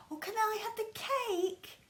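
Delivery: put-on high, whispered child's plea. Lester asking his mum for cake, high and whispered. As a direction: ask for the cake in a tiny hopeful whisper.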